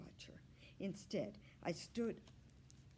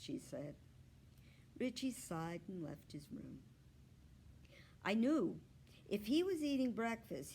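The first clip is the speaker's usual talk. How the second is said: usual speaking voice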